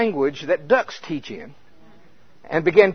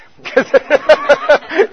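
high burst of laughter; 13 semitones higher, 10 dB louder than usual. Real, not acted.

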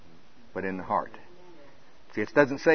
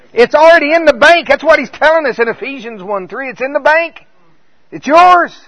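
much higher, louder, ringing proclamation; fervent preaching; 7 semitones higher, 12 dB louder than usual. Acted. Preacher exhorting the congregation, loud and high. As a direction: preach it full force to the church.

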